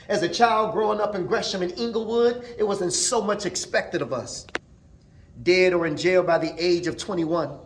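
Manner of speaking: spoken-word poem. Acted. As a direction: recite it like a hip-hop poet honoring a lost brother, punching the beats. Recites own memorial poem after talk.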